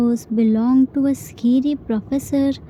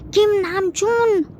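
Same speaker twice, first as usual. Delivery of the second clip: high, drawn-out yell from the kitchen; annoyed yell; 8 semitones higher than usual. A narrator's reading. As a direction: call him out, irritated. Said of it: "slightly annoyed and yelling from kitchen".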